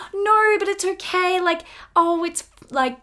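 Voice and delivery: high-pitched, glossing-over reassurance; breezy dismissal. Acted. Past self brushing off a friend's failed test, high breezy dismissal. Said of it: "I would just, like, brush it off".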